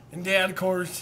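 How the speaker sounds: ordinary talk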